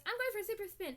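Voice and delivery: a hint of a cartoon girl; eager girl voice. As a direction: announce it like a proud little hero. Voicing a Powerpuff Girl.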